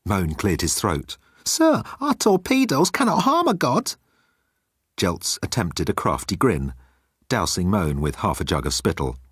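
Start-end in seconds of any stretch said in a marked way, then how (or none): 1.5-3.9 high, timid alien voice; high-pitched nervous alien; Moan's voice. Acted. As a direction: play Moan small and pleading.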